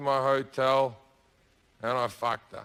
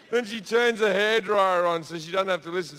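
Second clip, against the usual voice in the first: ordinary talk, nothing marked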